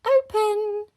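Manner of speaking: high, loud magic command; commanding child's shout. A narrator's reading. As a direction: shout it like a child casting a spell. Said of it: "pointed at the door and shouted".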